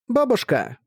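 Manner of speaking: put-on high sugary child's greeting; sweet voice for grandma; sweet little girl voice. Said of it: "поздоровалась сладким голоском".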